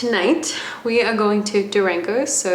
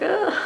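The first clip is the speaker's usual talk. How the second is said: nervous ugh; 12 semitones higher than usual. Retold or imitated. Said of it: "I'm just like".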